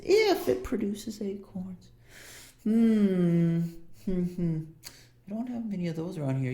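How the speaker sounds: put-on high, musing old shop woman; shopkeeper woman. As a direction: ponder the order in a high, fussy voice. GM voicing the shopkeeper.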